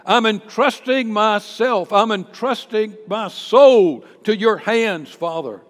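loud, emphatic acted prayer; full-voiced vow of trust, easing on the last word; fervent declaration. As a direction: proclaim it forcefully, stretching the key words. Preacher voices Jesus' act of faith.

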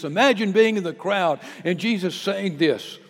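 ordinary talk

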